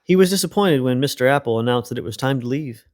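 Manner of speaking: ordinary delivery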